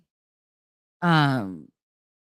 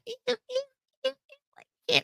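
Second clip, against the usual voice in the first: very high, softer little squeals; mock squeamishness; 8 dB quieter, much faster than usual. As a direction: squeal it lightly like someone squeamish about worms. Mimicking a squeamish reaction to worms.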